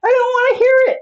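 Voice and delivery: very high, loud mock shriek; hammed-up outraged screech; screaming, imitated. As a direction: scream it like an irate neighbor from a window. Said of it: "screaming out her window".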